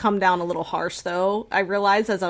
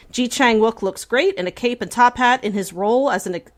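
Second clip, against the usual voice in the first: ordinary talk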